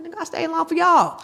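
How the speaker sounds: high, loud exasperated retort. Retold or imitated. Mother answering her sons, high and exasperated. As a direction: throw it back at her sons. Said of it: "I'm like".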